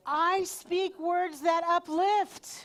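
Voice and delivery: slow, high, loud declaration; energized affirmation. Shown as a demonstration. Leader urging a standing group to echo, energized affirmation. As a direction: proclaim it slowly with full energy. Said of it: "Put your energy into this".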